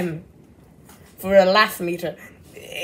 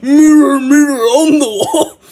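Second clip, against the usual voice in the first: loud, laughing mock of the singer's line; 8 dB louder than usual. Mocking the song's singer to his viewers, loud and laughing. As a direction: belt it out while cracking up. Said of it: "Did you see his voice?".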